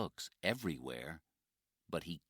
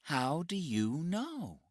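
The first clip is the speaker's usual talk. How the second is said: put-on puzzled young woman; 11 semitones higher, 4 dB louder than usual. Jenny questions Mike.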